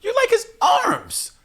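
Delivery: high, slow, drawn-out mocking voice; mock swooning woman. Mocking women who fancy [name], high and swooning. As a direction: play the women drooling over him. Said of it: "You don't like [name]'s acting".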